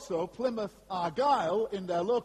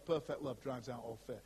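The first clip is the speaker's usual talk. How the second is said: tender and soft; 8 semitones lower, 7 dB quieter than usual. Real, not acted. Tender repetition of verse.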